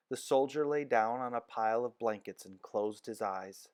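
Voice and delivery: usual speaking voice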